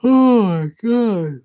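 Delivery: high, slow, drawn-out oh my God; mock dramatic gasp. Teased about a story, he gasps in mock shock. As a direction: ham up the shock.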